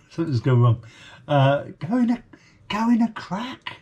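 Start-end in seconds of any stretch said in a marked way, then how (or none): 1.8-3.8 high mock-dim voice with a hint of Birmingham; squeaky mock impression of a stupid bloke; imitating the clip's line. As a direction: parrot the daft line in a silly high voice. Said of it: "one of my favourite lines that he ever says".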